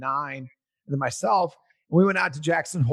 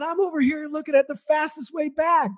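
defensive; 12 semitones higher than usual. Retold or imitated. Arguing with wife at sign.

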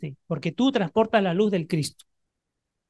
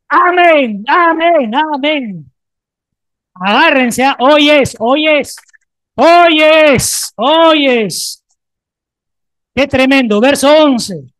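loud, high, fervent preaching; full-force preaching; 5 semitones higher, 8 dB louder, slower than usual. Acted. Pastor rousing the congregation after the declaration, fervent preaching. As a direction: preach it with all your fire.

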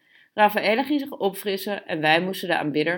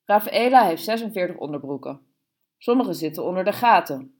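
plain reading voice

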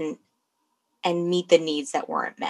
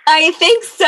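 eager agreement; 11 semitones higher, 10 dB louder than usual. Real, not acted. Agreeing eagerly to Florida trip.

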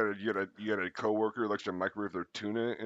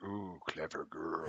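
hunter voice; 6 dB quieter, much slower than usual. Retold or imitated. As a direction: savour it like a stalking hunter. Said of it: "and he's like".

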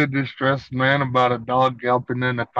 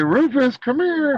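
high coaxing call; calling Rufus; 10 semitones higher than usual. Shown as a demonstration. Friend shows how to call the lost dog, high call. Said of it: "and say".

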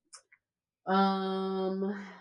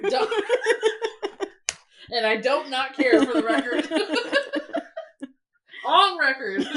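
laughing, louder, slower echo; 6 semitones higher, 7 dB louder than usual. Retold or imitated. Host laughs while repeating the wife's double negative. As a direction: laugh while teasing her words.

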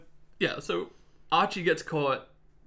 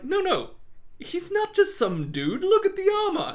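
much higher, hammed-up guards protesting; mock pleading guards; 6 dB louder than usual. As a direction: guards urge him to look at the armor, mock-pleading. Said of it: "they're like".

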